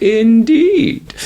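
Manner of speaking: high, drawn-out comic reply; mock agreement. Host agreeing Seagal is a well-qualified martial artist, mock high-pitched. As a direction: stretch it out way up high, tongue in cheek. Playing along with Seagal joke.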